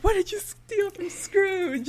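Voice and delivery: high, loud mock accusation; imitated yell. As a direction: yell it like an outraged kid, in retelling. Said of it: "just yells".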